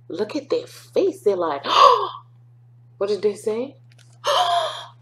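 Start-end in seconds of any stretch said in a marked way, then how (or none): 1.6-2.1 high, loud startled oh; shocked gasp. Acted. Baker couple gasp. 4.0-4.9 high gasp. Acted. Baker gasp.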